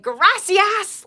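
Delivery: put-on high, loud gracias in a broad American accent; American-accented Spanish. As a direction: imitate grandpa's very American Spanish. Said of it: "Like, so American, the way he said it".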